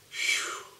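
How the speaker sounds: breathy whispered phew of relief. Real, not acted. Recalling his first kiss, a whispered phew.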